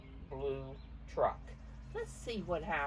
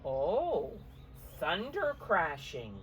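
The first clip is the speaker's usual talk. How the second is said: louder, slower storm lines; dramatic storybook reading; 4 dB louder, slower than usual. A narrator's reading. Grandmother reading storm rhyme to children, louder and slower. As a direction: read it like a storm rolling in.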